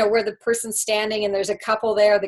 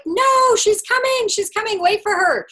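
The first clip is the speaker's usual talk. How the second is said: much higher, loud insistent protest; urgent plea; 11 semitones higher, 5 dB louder than usual. Retold or imitated. Counter agent begging the gate to wait, high and loud. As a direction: beg them to hold the plane.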